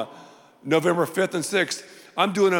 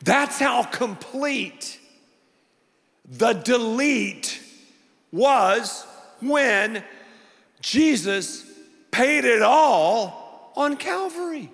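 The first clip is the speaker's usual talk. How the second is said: higher, emphatic preacher's delivery; impassioned preaching; 7 semitones higher, slower than usual. Real, not acted. Preacher assures the redeemed they are righteous, impassioned emphatic preaching. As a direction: build phrase on phrase, holding the key words.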